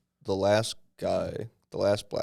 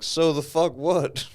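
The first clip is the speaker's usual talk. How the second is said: dismissive shrug; 8 semitones higher, 4 dB louder, slower than usual. Retold or imitated. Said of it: "they're just like".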